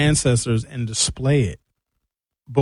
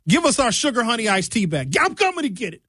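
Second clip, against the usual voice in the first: family demanding it back; 9 semitones higher, faster than usual. Retold or imitated. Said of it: "the family can be like".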